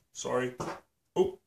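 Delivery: usual speaking voice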